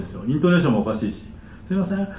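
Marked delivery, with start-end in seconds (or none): 1.6-2.1 higher, softer polite request; well-formed sentence said softly; small voice, properly pronounced. Shown as a demonstration. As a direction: ask politely in a small, clear voice. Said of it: "小さい声で喋れたらわかるのに".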